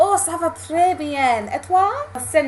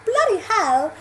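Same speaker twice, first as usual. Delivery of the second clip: higher, louder posh British question; posh British accent; 7 semitones higher, 5 dB louder than usual. Shown as a demonstration. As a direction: try "bloody hell" as posh British people would. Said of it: "do posh people say bloody".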